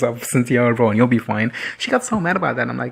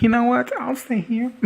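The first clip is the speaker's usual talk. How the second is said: mock acceptance; 12 semitones higher than usual. Imagines happily accepting a new life.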